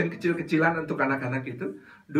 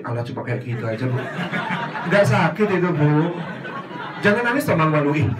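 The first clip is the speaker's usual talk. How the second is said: loud, scoffing brush-off; dismissive child; 4 dB louder than usual. Acted. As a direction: wave off her pain.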